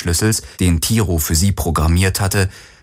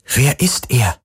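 higher-pitched, tense question; 5 semitones higher, slower than usual. A narrator's reading. Kirai asks Galen who Khan is, sounding tense. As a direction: press for the answer, a touch on edge. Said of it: "Sie klang angespannt".

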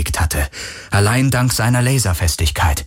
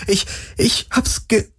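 faltering; 14 semitones higher than usual. Acted. Breaks off seeing bodies.

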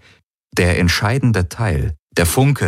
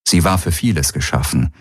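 ordinary delivery

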